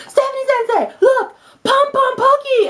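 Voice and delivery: high-pitched imitation of a pupil; excited student. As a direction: imitate an excited kid calling the teacher. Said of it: "one of my students came up to me".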